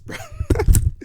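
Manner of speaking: bursts into a high cackle on bruv; can't hold the laugh in; loud giggling fit. Real, not acted. Cracking up over useless player.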